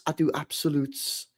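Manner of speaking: slow, put-on character delivery; mimicked catchphrase, slowly stretched; impression voice. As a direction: draw it out as a mock pronouncement. Impression continues, settling lower.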